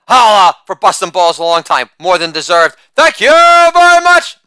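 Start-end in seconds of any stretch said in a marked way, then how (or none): 0.1-0.6 loud, high shouted hype word; catchphrase shout. Acted. Catchphrase shout after joke. 3.0-4.4 much higher, slow, loud showman's thank-you; put-on catchphrase voice. Repeated catchphrase after joke.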